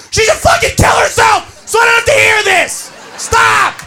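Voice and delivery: sudden high, harsh yell; exasperated outburst. Acted. Guy fed up with Debbie questions, exasperated shouting. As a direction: explode after too much pestering.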